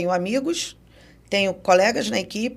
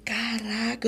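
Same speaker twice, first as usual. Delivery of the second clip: stunned hush; 8 dB quieter, much slower than usual. Real, not acted. Stunned inner reaction.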